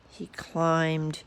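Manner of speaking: plain reading voice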